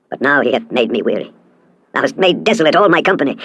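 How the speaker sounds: plain reading voice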